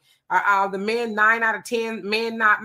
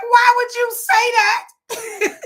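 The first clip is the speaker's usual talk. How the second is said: shocked stranger asking why; incredulous movie-goer; 10 semitones higher, 6 dB louder than usual. Retold or imitated. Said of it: "the man next to me was like".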